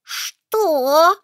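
high startled what; shocked what. Acted. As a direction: jolt up in total surprise. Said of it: "одновременно произнесли лягушка и кузнечик". (изумлённо, высоко)

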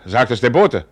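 sharp, loud and high question; startled demand. Acted. Father reacts to the bad news, startled and loud. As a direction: snap the question out in alarm.

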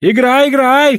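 very high, urgent cry; shouting. A narrator's reading. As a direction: goad the opponent with a loud shout. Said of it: "крикнул Гао".

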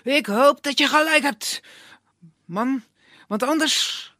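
high, loud cawing bird voice; talking crow. Acted. The bird warning Dimitri, high cawing voice. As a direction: threaten like a cheeky crow.

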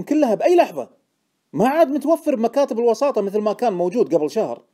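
high, loud, heated insistence; indignant certainty. A narrator's reading. Informant answering Deacon's doubt, indignant and excited. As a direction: protest loudly that you are certain.

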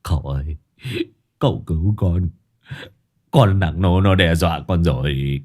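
low, whiny mock sobbing plea; a touch of blubbering. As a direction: blubber for rescue. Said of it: "mếu máo".

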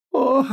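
high-pitched sobbing gasp; a short, high whimpered "oh"; sob. A narrator's reading. Wife gasping at her monstrous husband, sobbing. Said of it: "всхлипнула она".

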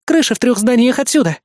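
high-pitched urgent directions; exclaimed report. A narrator's reading. Assistant locating the alarm, exclaiming. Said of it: "воскликнула Эрис".

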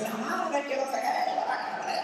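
high mock curse that fades to a hiss; mock cursing. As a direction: spit out a half-swallowed curse in jest. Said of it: "En vez de decir".

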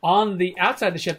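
ordinary talk, nothing marked

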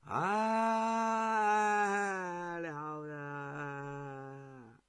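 咧嘴放声假哭，拖长音; 戏谑地干嚎; 夸张哭腔. Acted. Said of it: "咧开嘴就哭啊". (苏秦在齐王面前假装大哭)

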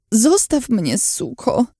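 high, strained moan of pain; moaned curse. A narrator's reading. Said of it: "wyjęczałam".

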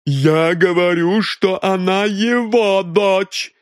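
high and loud, slowed insistence; insistent shout. A narrator's reading. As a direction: dig in and refuse to yield. Mrs Creel insists, arguing. (миссис Крил упрямо настаивает на своём)

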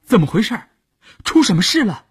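high-pitched, startled questions; hurried questioning. A narrator's reading. Puzzled people on the boat to Wuyun, hurried high questions. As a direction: ask at once, pitch jumping up. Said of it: "连忙问".

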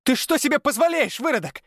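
put-on very high shrieking girl's voice; voiced girl shrieking with outrage. A narrator's reading. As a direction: screech the insult. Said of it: "Завизжала она".

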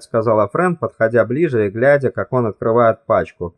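ordinary delivery, nothing marked

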